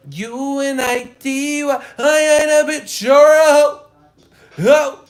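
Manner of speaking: very high, loud mock rap shout. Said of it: "on some [name] hype".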